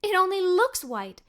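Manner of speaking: plain reading voice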